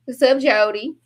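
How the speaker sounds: normal talking voice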